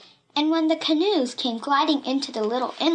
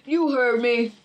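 put-on bully voice; menacing villain. A narrator's reading. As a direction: demand it as the story villain. Villain repeats his demand.

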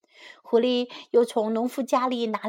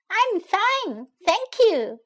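high-pitched little chick voice; 9 semitones higher, slower than usual. A narrator's reading. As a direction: answer politely as a tiny chick. Pip answers the fox.

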